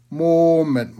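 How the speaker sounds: loud, high, drawn-out command to wait; emphatic, booming hold-on; calling to halt. A narrator's reading. Teacher stops boy running off.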